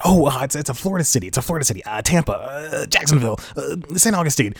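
halting guesses with a touch of panic; stumped contestant. Acted. Contestant guessing at a song on Beat Shazam, lightly acted fluster. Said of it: "And he goes".

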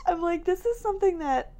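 ordinary talk, nothing marked

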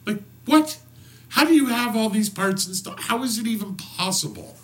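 high, disbelieving exclamation; baffled and delighted; amazed. Real, not acted. Amazed outburst.

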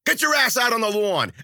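loud, high-pitched bark; yelled order. A narrator's reading. Guard ordering the dawdling narrator outside, yelling. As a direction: yell it right in his face. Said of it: "and yelled".

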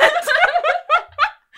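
big high real laugh; loud burst of laughter. Real, not acted. Friend hearing a terrible Cockney, laughing loudly. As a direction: crack up at a bad accent.

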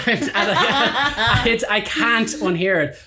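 words through laughter; giggling, slow, barely getting words out; laughing. Real, not acted. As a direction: laugh while trying to talk. Laughing at misheard lyric.

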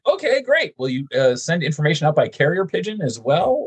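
high mock-sarcastic reply; sarcastically high and bright. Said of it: "It's like".

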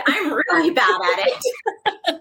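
drawn-out words through a laugh; laughing admission. Real, not acted. Admitting her bad story voices to the host, laughing. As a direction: laugh while owning up to it.

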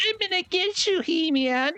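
high screechy cartoon villain; Skeletor voice. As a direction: squeal it like a cartoon baddie. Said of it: "Skeletor's like".

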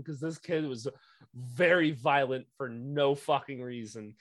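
normal talking voice

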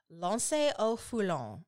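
"Lancy-au-Foulon" said the French way, lon-say-oh-foo-lon; non-native French "Lancy-au-Foulon"; attempted French pronunciation. Shown as a demonstration. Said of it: "might have said completely wrong".

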